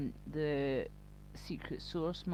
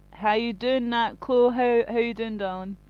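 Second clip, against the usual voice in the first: loud, raised-pitch hello; real shouted greeting; 4 semitones higher, 8 dB louder than usual. Real, not acted. Streamer greeting a viewer who joins, shouting. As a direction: call out a greeting too loudly. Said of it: "sorry if I'm shouting".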